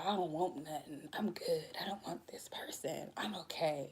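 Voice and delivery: whispered mock serenity; hushed mock zen denial; fake calm whisper. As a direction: breathe it like fake inner peace. Mocking fake detachment.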